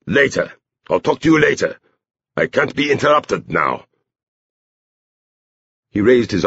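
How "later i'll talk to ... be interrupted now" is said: higher-pitched, agitated brush-off; Mister Tree. A narrator's reading. Man waves off the girl, high impatient call. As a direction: call it out high, too busy to stop. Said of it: "Mister Tree called".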